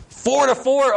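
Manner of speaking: high-pitched playful disbelief; surprised. Real, not acted. Preacher tallying the kids' cake-or-pie votes, surprised. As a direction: react to a sudden tie, pitch leaping up. Surprised by tied vote count.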